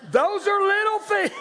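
very high, loud comic squeal; high mock outcry. Preacher exclaiming over petty complaints, high comic outcry.

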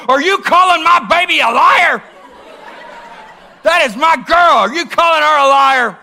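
father shouting at the boss over the phone, enraged; furious shout. Retold or imitated. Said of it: "calm, calm down".

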